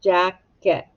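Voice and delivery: Jacket with a gap between jack and et; two distinct beats on Jacket; split into syllables. Shown as a demonstration. Teacher scooping syllables for the class, Jacket in two beats. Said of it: "Scoop it".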